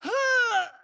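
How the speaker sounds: high startled gasp; high shocked gasp; sharp gasp. Acted. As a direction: suck in a shocked breath. Gasp sound line.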